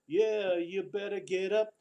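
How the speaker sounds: ordinary talk, nothing marked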